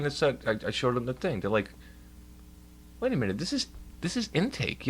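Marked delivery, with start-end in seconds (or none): 3.0-4.9 high-pitched imitated confusion; puzzled staffer. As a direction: act out a clerk caught off guard. Acts out puzzled intake staff.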